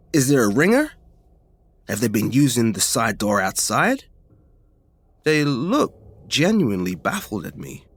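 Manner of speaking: ordinary delivery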